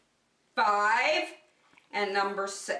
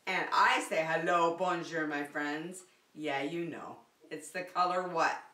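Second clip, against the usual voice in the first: ordinary talk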